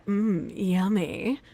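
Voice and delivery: slow, low, soft "Mm, yummy"; flirty yummy. Shown as a demonstration. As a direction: linger flirtatiously over "Mm, yummy". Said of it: "depends on how she says it".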